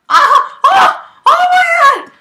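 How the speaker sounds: high shrieking panic; panicked scream. Real, not acted. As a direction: scream as you plunge. Falling in game.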